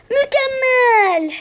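high, very slow laughing cheer; delighted cheer. Real, not acted. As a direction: stretch it out through a laugh. Cheering after goal.

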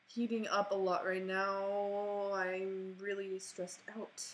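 long, slow stretched vowels on one note; slow, sustained monotone whine; drawn-out complaint. Real, not acted. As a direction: drag every word out. Drawn-out complaint about computer and stress.

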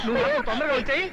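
urgent, high farewell plea; calling after someone. Acted. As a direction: call after your wife as the bus leaves. Husband calls after departing bus.

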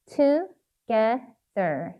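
"Together" very slowly, in three separate syllables; sounding out while writing. Shown as a demonstration. Teacher writing "Together" for learners, sounding it out slowly. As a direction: break "Together" into to, ge, ther. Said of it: "발음하시면서 꼭 쓰셔야 돼요".

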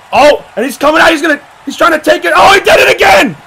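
high, loud, rising frenzy; frantic ringside commentary; shouted play-by-play. Real, not acted. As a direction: call it in disbelief as it happens. Live play-by-play of ankle lock.

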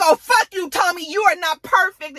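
high, loud acted outburst; heated mock-quarrel voice; angry retort. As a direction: fire back high and indignant. Acted quarrel, mother insulting son.

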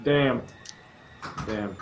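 ordinary delivery

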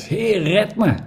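high, pleading cry for rescue; desperate cry. Acted. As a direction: call out as if sinking. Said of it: "en schreeuwde het uit". (wanhopige noodkreet, hoog)

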